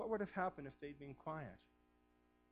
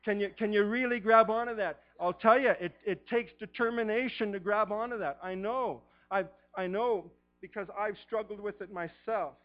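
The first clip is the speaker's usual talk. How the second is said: much louder and higher, urgent; fervent preaching; 6 semitones higher, 12 dB louder than usual. Acted. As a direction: call it out to the congregation. Preacher rising to full force.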